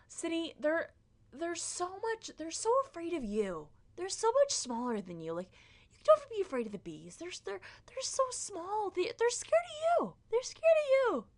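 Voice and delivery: high-pitched imitation of a pleading mom; mock mom voice. Mom reassures her kid about the bees, high mock-mom voice. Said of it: "that's a great impression".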